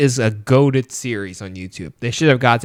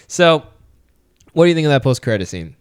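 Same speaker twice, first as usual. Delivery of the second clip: ordinary talk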